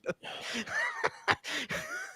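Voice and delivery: long, high laugh of disbelief; high incredulous laugh. Real, not acted. Laughing at the absurdity.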